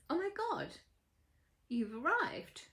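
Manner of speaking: lightly acted amazement at an arrival; awed greeting. Astonished greeting in acted story.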